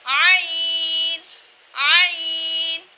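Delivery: عین very drawn out with a pharyngeal start; ain from mid-throat. Shown as a demonstration. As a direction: squeeze عین from the middle of the throat and hold it. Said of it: "عین کی آواز کو ہم حلق کے درمیان سے نکالتے". (حلق کے درمیان سے لمبا عین)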